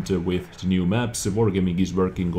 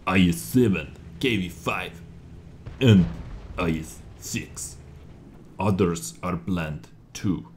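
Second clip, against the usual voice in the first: put-on Russian accent. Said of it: "rate my Russian accent".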